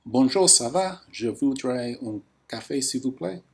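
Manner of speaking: put-on English-accented French, spoken up and clearly to the voice app; café customer ordering from the AI barista, loud and careful. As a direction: order a coffee out loud, over-clear for the recognizer. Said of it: "so let's start".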